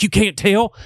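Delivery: high-pitched mock disbelief; incredulous question. Accent lover to British listeners, playfully incredulous. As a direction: rise sharply, astonished they can't hear it.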